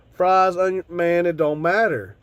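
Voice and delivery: usual speaking voice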